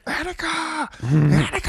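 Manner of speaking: high, strained mock cry, held back in volume; high-pitched young actor. Quoting Dog Day Afternoon, high-pitched imitation of the young actor. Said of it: "a real high-pitched thing".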